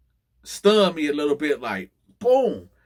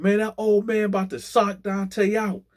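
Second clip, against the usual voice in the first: yelling out; 9 semitones higher than usual. Retold or imitated. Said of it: "dudes yelling out".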